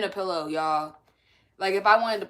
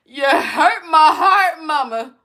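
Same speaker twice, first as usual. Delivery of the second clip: slow, loud, laughing wail; hammed-up heartbroken child; mock hurt kid; 5 semitones higher, 10 dB louder, slower than usual. Kid wailing to mom, mock hurt.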